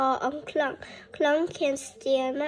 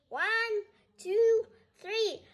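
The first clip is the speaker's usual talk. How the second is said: counting in; 8 semitones higher, slower than usual. Shown as a demonstration. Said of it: "Let's clear our throat".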